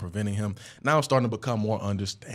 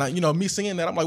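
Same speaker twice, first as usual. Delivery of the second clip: ordinary talk, nothing marked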